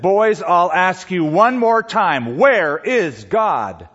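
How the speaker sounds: slow, emphatic exasperated pastor; very frustrated. Acted. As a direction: stretch out each word of the final demand. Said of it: "got very frustrated and said".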